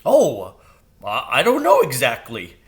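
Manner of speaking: flustered bird voice, stumbling; hesitate, caught off guard. A narrator's reading. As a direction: crow caught by the question, stammering. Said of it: "Jeremy said".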